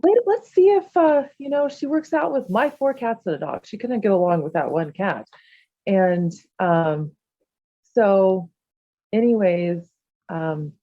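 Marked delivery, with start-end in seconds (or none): 0.0-5.3 eager suggestion. Retold or imitated. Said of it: "And I was like".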